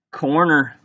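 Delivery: ordinary talk, nothing marked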